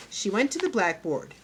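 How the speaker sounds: usual speaking voice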